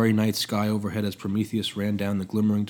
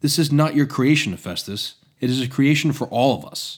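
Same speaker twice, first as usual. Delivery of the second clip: impassioned and louder; passionate; 4 semitones higher, 7 dB louder than usual. Acted. Apprentice arguing for mankind's gift, passionately. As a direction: plead the cause with fire. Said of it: "He spoke passionately".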